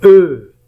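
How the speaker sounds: loud drawn "E", the French eu sound; isolated vowel sound. Shown as a demonstration. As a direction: sound out "E" as in cœur, firmly. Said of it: "Le son".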